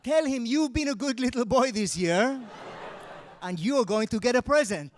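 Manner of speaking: high, loud mock parent urging; hammed-up eager parent voice; mock cheerful parent shoving kid toward Santa; parent pushing kid to Santa. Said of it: "for one month you're like".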